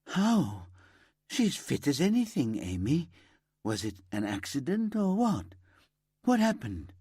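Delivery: stunned friend pressing for answers; shocked disbelief. A narrator's reading.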